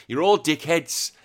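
usual speaking voice

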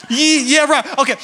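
ordinary delivery

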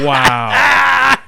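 shouted wow, laughing; a big teasing exclamation; loud mock amazement. Real, not acted. Laughing at friend's gullibility.

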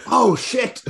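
normal talking voice

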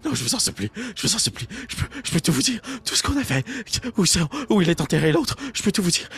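high, frantic pleading; terrified begging. Acted. Old man on his knees offering to tell everything, terrified begging. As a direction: beg for your life, voice pitched high.

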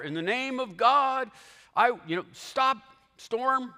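lightly hammed-up spell; mock incantation. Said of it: "appeal to an incantation".

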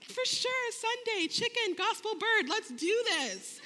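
high-pitched, gleeful acceptance; rally excitedly, drawing out the final words; hyped-up agreement. Retold or imitated. Retold eager yes to cooking.

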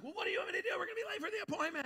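high, fast whiny wife voice; mock whiny wife. Wife complains about the appointment, hammed-up high whine. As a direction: ham up her complaint, quick and high. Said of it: "She's like".

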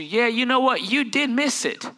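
usual speaking voice

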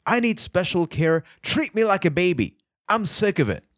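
exaggerated high-pitched mock pleading; self-mocking. Shown as a demonstration. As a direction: ridicule your own neediness, pitched high all through. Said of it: "I'm mocking myself".

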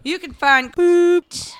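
slow, loud, teasing drawl; drawn-out teasing. Pretend. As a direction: stretch it out to tease. Joking about street name.

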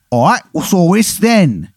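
high-pitched, hammed-up bad English accent; terrible English accent. Intruder introducing himself in a terrible English accent. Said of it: "He's got a terrible English accent".

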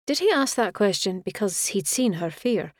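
ordinary delivery, nothing marked

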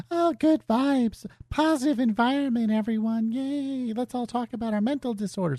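high, sugary mock positivity; mocking Care Bear. Gamer parodying Care Bear streaming communities, saccharine mockery. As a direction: imitate an over-sweet, soft community cheerleader. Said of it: "the fucking Care Bear bullshit fucking communities".

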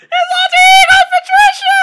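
very high, loud laughing squeal; cracked-up comic scream; hysterical laughing shriek. Pretend. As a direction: shriek it through helpless laughter. Cracking up at the punchline.

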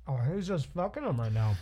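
talking through a mouthful of food; chewing while talking. Real, not acted. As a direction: mouth full. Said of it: "Sorry, I'm chewing right now".

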